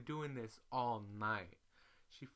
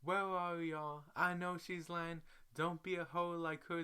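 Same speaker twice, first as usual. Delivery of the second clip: mock cussing out; 7 semitones higher than usual. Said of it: "he starts cussing me out".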